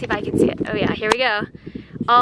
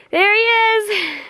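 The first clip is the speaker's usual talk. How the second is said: high, slow and drawn out; delighted; 9 semitones higher, 3 dB louder, slower than usual. Real, not acted. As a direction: call it out in happy surprise. Spotting guide far above.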